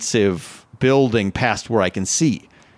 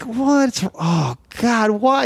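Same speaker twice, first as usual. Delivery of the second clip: high despairing whine; 9 semitones higher, much slower than usual. Retold or imitated. Despairing alone in room.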